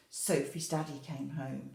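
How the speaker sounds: ordinary delivery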